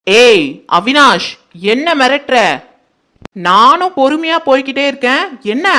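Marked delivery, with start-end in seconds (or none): 0.1-1.3 a drawn-out, loud warning call of his name; slow warning. Acted. Aunt rounds on Avinash, slow angry warning. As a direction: stretch the name as a threat. Aunt turns on nephew angrily.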